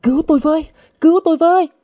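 very high, loud desperate cries; screaming for help. A narrator's reading. Captive crying for rescue from her attacker, screaming. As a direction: scream out in despair. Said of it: "Cô gào lên".

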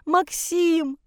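high mother's cry; distraught wail. Acted. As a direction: call after him in anguish, pitched high. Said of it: "За спиной рыдала мать". (отчаянный высокий вопль)

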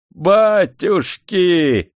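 high, loud, drawn-out shout of delight; overjoyed exclamation, stretched long; joyful cry. A narrator's reading. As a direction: cry out in happy amazement. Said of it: "обрадованно закричал цыган".

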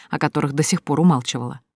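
usual speaking voice

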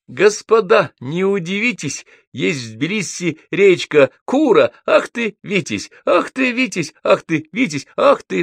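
very high declaimed verse; epigram recitation. A narrator's reading. Гнедич performs his epigram on Руставели, squeaky recitation.

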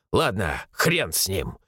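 low gruff dismissal, only mildly angry; сдержанно рявкая. A narrator's reading. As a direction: drop the pitch and dismiss it curtly. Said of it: "рявкнул Захаров". (низко, с лёгким раздражением)

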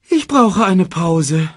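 high, slow, loud moan; tired cub's drawn-out complaint; worn-out whine. Acted. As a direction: drag the words out, exhausted. Exhausted cub drops into the grass.

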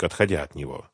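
ordinary delivery, nothing marked

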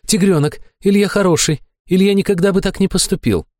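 high-pitched earnest assurance; high character voice. A narrator's reading. Companion reassures the tigerling about Ilya, high and earnest. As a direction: speak high, vouching for Ilya.

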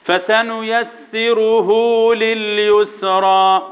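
drawn-out recitation on a sustained tone; light-lettered recitation. Shown as a demonstration. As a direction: intone the verse with light consonants. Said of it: "كلها مرققه".

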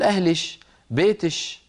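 "أهلش" and "بيتش" ending in sh; Hadrami kashkasha. Shown as a demonstration. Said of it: "إذا خاطبوا الأنثى يقول".

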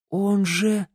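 high, halting realisation; stunned dismay. A narrator's reading. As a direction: break off as the shock lands. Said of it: "ошарашенным взглядом". (ошарашенно, с испугом)

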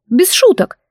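высокое строгое предупреждение; отрежь строго, высоким голосом. A narrator's reading. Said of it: "сообщила Бри строго". (строгое предупреждение)

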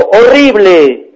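high, loud yell into a tunnel; sudden piercing storybook cry; child's shout. A narrator's reading. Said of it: "El niño gritó".

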